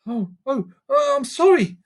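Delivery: put-on high, startled girl's apology; a high girl's voice blurting out her sorry; Lucy, sorry.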